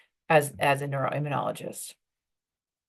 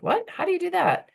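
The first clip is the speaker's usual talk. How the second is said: intrigued "what, how do you do that?" rising high; curious surprise; 12 semitones higher than usual. Retold or imitated. An event acquaintance reacting to an intriguing job line, surprised and rising high. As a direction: someone at an event caught off guard by the answer. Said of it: "They're like".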